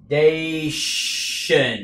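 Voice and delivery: slow "dation" with a long hiss in the middle; long S sound. Shown as a demonstration. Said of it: "âm S dài". ("dation" kéo dài âm S)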